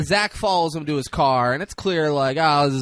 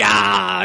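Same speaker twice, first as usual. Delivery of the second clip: mock exclamation; 7 semitones higher, 8 dB louder than usual.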